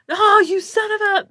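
squeaked high in mock outrage; a high, indignant half-curse cut off. Scene: guest caught out by Tatum's voice, high mock outrage.

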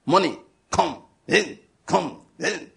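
short chanted commands, each "come" punched separately; mock shouted prayer. Preacher mocking people praying for material things, mock shouting. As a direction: mimic someone summoning possessions in prayer. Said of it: "You see them shouting".

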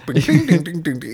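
chanted ding ding jingle; sung ringtone chime. Retold or imitated. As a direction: imitate the phone chime. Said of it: "the Motorola ringtone".

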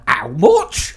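loud shocked price outrage. Retold or imitated. Retelling shock at cap prices.

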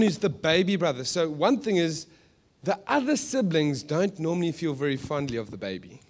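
ordinary talk

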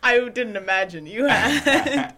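laughing. Real, not acted. Laughing reply.

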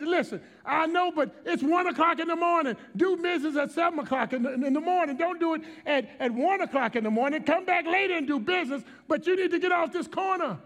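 high, insistent exasperated scolding; frustrated. Retold or imitated. Pastor telling woman to leave at night, frustrated retelling. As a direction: argue her off the corner, re-enacted. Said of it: "I was so frustrated".

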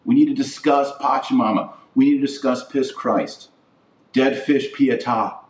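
ordinary delivery

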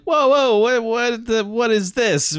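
bewildered, drawing it out; hammed-up alarm at the collapse; startled. Staff startled by collapsed girl.

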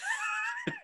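a squeal of laughter; high-pitched laugh. Real, not acted. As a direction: shriek of laughter. Laughing at brunch remark.

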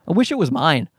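usual speaking voice

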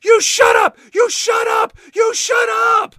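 high shrieking scream, repeated. Retold or imitated. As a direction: scream it at the top of your voice. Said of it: "shouting at the top of her voice".

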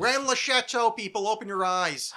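loud, high, hammed-up zealot call; mock conspiracy cry. Parodying conspiracy believers to co-host, hammed-up loud proclamation. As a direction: proclaim it like a street preacher.

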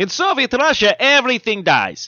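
high, hammed-up Russian accent; mock Russian doom in a Russian accent. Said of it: "That's the only accent I got right".

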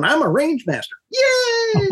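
put-on high squealing cheer of a proud little girl; mock childish glee over a certificate; giddy little girl. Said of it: "skipping around like a little girl with my coat on going".

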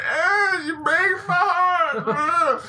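high, slow, whimpering you break my heart; hammy crying Padme. Retold or imitated. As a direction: overact a tearful heroine. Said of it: "[name]'s impersonation of every single person".

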